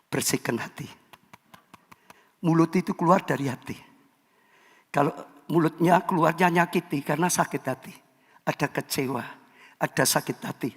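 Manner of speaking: quiet, gentle urging; soft pastoral appeal. Real, not acted. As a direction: speak softly to the heart.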